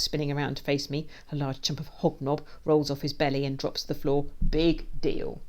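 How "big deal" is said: loud, cocky brush-off; dismissive scoff. A narrator's reading. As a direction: shrug off the threat with swagger. Villain scoffs at warning.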